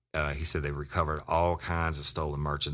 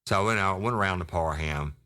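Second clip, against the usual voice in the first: usual speaking voice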